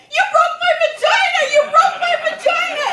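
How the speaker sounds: high loud accusing yell; hammed-up outraged yell. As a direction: shout it at him, outraged. Yelling at boyfriend in pain.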